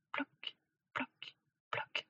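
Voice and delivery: very quiet, separated drips; soft raindrop sounds. A narrator's reading. Narrator imitating first rain on the square, hushed. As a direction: let each little drop land softly.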